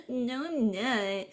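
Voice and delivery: small, high, soft drawn-out voice; little Dave protesting. Retold or imitated. Little brother told he's too small, meekly protesting. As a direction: whine it quietly like a little kid.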